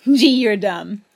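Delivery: giggling, slow and loud; laughing. A narrator's reading. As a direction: laugh wildly through it. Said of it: "He began to laugh insanely".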